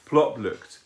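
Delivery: ordinary delivery, nothing marked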